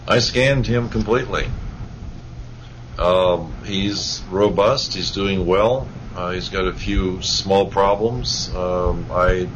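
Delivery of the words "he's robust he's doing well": ordinary talk, nothing marked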